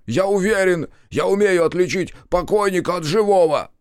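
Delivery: high, loud, hysterical insistence; worked-up, high-pitched yell; panicked shriek. A narrator's reading. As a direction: squeal it back at the doubters. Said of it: "взвизгнул Макар".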